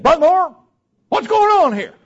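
high, loud retold outburst; acted shout of alarm; indignant demand. Acted man confronting the preacher.

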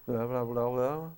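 mock mumbling. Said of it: "I heard the no's".

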